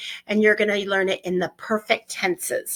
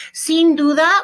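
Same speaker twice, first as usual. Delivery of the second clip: Sin duda said higher and louder; bright model phrase; 7 semitones higher, 7 dB louder than usual. Shown as a demonstration. Teacher presenting new Spanish phrase to class, louder and higher. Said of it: "teaching the phrase".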